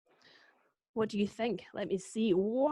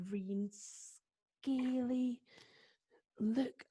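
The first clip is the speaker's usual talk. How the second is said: spooky storytelling; 4 semitones lower, 7 dB quieter than usual. A narrator's reading. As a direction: draw them down into the dark. Story narration, dark and hushed.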